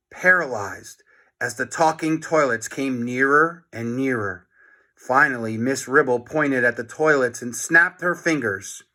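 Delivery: ordinary delivery, nothing marked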